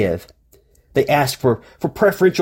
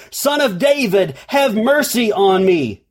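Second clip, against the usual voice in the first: much higher pitch, drawn-out ending; high pleading mock shout; imitated loud cry; 7 semitones higher than usual. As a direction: cry out for mercy over a crowd, volume restrained. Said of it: "he became even louder".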